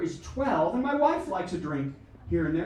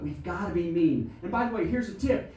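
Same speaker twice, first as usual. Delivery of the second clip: ordinary delivery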